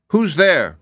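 high-pitched questioning call; girl calling out. A narrator's reading. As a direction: call through a door, high and loud. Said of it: "called a girl's voice".

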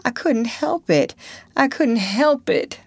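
high, moaned repetition; moaning plea. A narrator's reading. Potter moaning to the crowd, high and helpless. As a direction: moan it helplessly twice. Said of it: "Potter moaned".

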